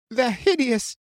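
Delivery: high-pitched cry of panic; panicked cry. A narrator's reading. Frightened onlooker on the bridge seeing monsters, panicked. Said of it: "felt the panic in that voice".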